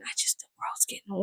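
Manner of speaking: hushed aside about the weird world; whisper. Real, not acted. Vlogger muttering to her viewers, whispered. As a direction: drop to a near whisper.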